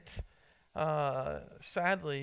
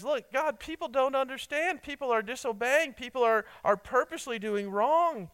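higher, louder anguished plea to God; a psalmist pleading, higher and louder; imitating a weeping plea; 8 semitones higher, 4 dB louder than usual. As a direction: cry out to God with mock anguish. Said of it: "that he's weeping, that he's crying".

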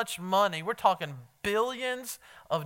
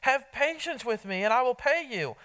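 pleading servant; 7 semitones higher, 4 dB louder than usual. A narrator's reading. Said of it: "pleaded with him".

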